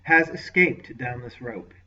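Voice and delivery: slow, held-back menace; a touch of suppressed anger, deliberate; contained venom. Acted. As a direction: lord reporting the escape with mild venom. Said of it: "with contained venom".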